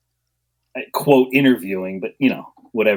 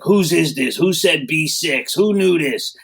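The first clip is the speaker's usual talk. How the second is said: demanding teacher; 7 semitones higher, slower than usual. Retold or imitated. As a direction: bark out who wrote it. Retold teacher demanding answer owner.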